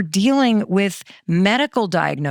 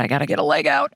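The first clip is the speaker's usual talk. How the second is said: low grumbling thought; 6 semitones lower, much faster than usual. Pretend. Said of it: "This is what my thoughts used to sound like".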